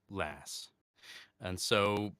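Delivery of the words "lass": said with an American accent. Shown as a demonstration. Showing fans how the word sounds in American, American accent. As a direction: give it an American pronunciation. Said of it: "say it in an American accent".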